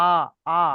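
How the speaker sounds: "আ" as an open, held "aa"; vowel demonstration. Shown as a demonstration. Teacher showing the zabar sound, "আ" held as aa. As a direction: sound out "আ" as a clear long aa. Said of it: "এই জাবারের উচ্চারণ হবে".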